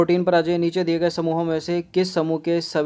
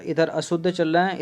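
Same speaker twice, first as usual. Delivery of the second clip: ordinary talk, nothing marked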